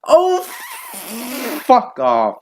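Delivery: high groan into loud curse; loud, fed-up reaction; exasperated outburst. Real, not acted. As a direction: explode in exasperation.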